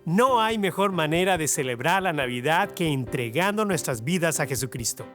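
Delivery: ordinary talk, nothing marked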